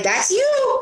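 very high excited push; lightly mimicked eager whisper-shout; excited nudge. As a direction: urge your friend on. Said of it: "I'm like".

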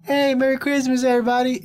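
high, loud mock voice brushing off the trip; mock himself on the phone. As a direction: ham up a cheerful excuse to skip the visit. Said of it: "that'd be me".

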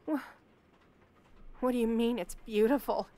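ordinary talk

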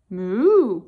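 low loud moo; cow mooing. Acted. Cow agreeing to the plan with a moo. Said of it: "said the cow".

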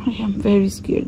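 normal talking voice

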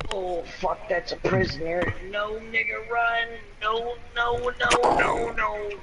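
high frantic no no no; panicked shouting. Real, not acted. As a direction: panic as the bomb drops. Prisoner about to be bombed.

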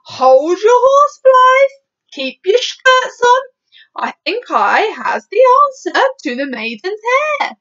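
high, loud, drawn-out call; excited BFG interrupting, voice way up; giant crying out. Acted. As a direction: halt them all with a slow, loud cry. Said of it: "cried the B F G".

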